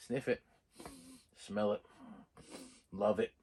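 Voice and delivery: soft, low savoring murmur; hushed savoring. Real, not acted. Unboxing new discs, savoring softly.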